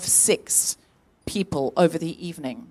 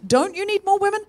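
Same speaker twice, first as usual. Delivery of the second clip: high-pitched, questioning mock plea; plaintive inner protest; 13 semitones higher than usual. Comedian voicing what was in her mind, plaintive protest. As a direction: protest in a small, raised voice.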